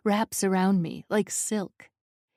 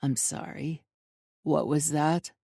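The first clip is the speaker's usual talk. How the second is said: dominant lover; 4 semitones lower than usual. A narrator's reading. Dominant man teasing her.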